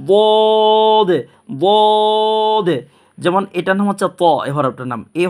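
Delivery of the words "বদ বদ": "বদ" held long and higher on one note; long, full-mouthed "বদ"; thick heavy sound. Shown as a demonstration. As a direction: intone "বদ" as a full, heavy dwooood. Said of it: "মোটা আওয়াজে".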